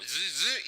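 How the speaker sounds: two buzzing "zzz" sweeps; imitated car scanner buzz. Speaker shows how he scans a place, buzzing like the car. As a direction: make the scanner noise yourself. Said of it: "Pues yo hago lo mismo".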